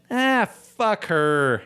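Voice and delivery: retelling his careless brush-off of the coworker; mock dismissive. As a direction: brush her off with contempt. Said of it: "and I said".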